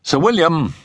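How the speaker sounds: a high-pitched friend telling the family history; Settle's explanatory voice. Acted.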